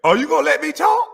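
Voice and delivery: high squeaky mock of the caller's dodge; hammed-up whiny mimicry; mocking imitation. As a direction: parrot his answer in a silly whine. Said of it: "His answer".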